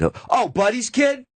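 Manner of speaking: high, mock-impressed recognition; imitating Dice. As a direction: swoop up as the name clicks. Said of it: "points his finger, and goes".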